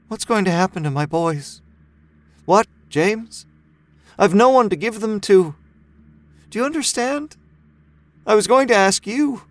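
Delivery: lightly voiced mother close to breaking; pleading mother. A narrator's reading. Mother begs neighbor to take her boys, desperate pleading.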